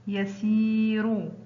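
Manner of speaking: يسير slowly, سي held long; drawn-out example word. Shown as a demonstration. Teacher showing pupils the long vowel, slow and stretched. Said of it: "ينطق سي".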